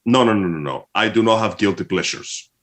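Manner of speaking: ordinary talk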